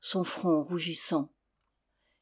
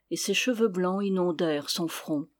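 ordinary delivery

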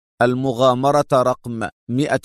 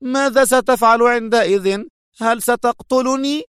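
much higher, louder, mocking challenge; nervous anger; 11 semitones higher, 3 dB louder than usual. A narrator's reading. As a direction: cut him off agitated. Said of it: "قاطعته لوتشيا في عصبية".

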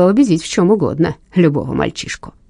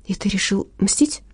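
whispering; 7 dB quieter than usual. Acted. Stunned question in tense scene.

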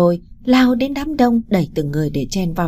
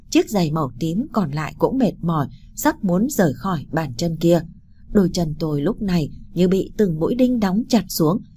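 plain reading voice

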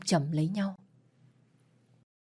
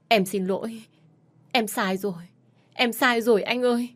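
wife crying into husband's back, apologizing; tearful apology; 4 semitones higher than usual. Acted. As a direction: voice rising and breaking into tears. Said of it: "Tôi khóc".

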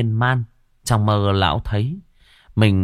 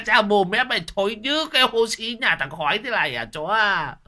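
howling outcry; 11 semitones higher than usual. A narrator's reading. Old man realizing the dog licked him, howling in disgust. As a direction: cry out in revulsion at the dog. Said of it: "rú lên".

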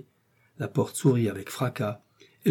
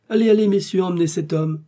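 high, loud order; forceful, raised dismissal; commanding; 9 semitones higher, 7 dB louder than usual. A narrator's reading. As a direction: send the guards off briskly. Ordering guards to take prisoner.

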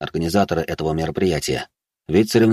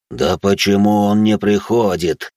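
plain reading voice

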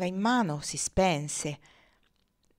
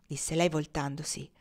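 usual speaking voice

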